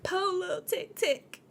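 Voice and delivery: high, soft sing-song mimicry of poor little tick; mocking sing-song. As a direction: tease her by singsonging. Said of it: "She said".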